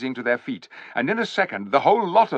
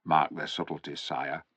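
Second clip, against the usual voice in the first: murmuring; 7 semitones lower, 8 dB quieter than usual. A narrator's reading. Poggin murmuring to king.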